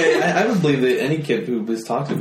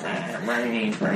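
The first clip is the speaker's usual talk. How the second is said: creaky, soft zombie groan; zombie arm; 6 semitones higher, 6 dB quieter, much slower than usual. Acted. Speaker mimics a severed zombie arm, creaky zombie moan. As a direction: groan it out like a feeble undead thing. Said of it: "the arm's not like".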